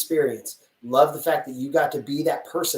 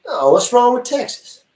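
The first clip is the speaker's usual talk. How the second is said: George Bush Texan; 10 semitones higher, 8 dB louder than usual. Pretend. Caught slipping into a George Bush Texan accent. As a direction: burst out in a Texas twang. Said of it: "I just turned into George Bush there?".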